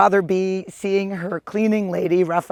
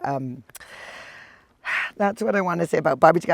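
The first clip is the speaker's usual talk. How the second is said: ordinary talk, nothing marked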